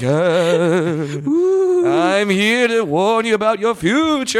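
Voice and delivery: slow spooky ghost voice; ghostly voice. Pretend. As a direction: moan it like a haunting spirit. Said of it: "I'm not a ghost coming in to be like".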